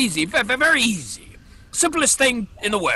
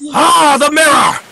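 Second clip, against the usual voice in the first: excited; 6 semitones higher, 9 dB louder, slower than usual. Acted. Pirate sees lost mirror.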